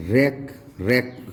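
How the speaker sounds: रेक with no W sound at the front; silent W. Shown as a demonstration. Teacher giving another W-R word to learners, silent W. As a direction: say रेक from a clean R, W unheard. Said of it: "तो W silent हो जाएगा".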